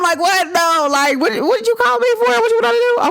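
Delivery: high-pitched hyped friend, sped up; eager friend voice. Retold or imitated. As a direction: pop up like a friend ready to roll. Friend answering call eagerly.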